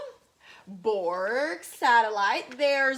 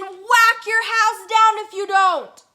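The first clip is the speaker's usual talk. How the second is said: higher, louder threat; big bad robot threatening loudly; 9 semitones higher, 8 dB louder than usual. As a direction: bellow the threat. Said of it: "the big bad robot said".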